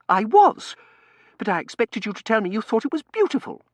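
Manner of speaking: high, loud, petulant miss; indignant Tossie. Acted. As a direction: scold the butler, voice pitched right up.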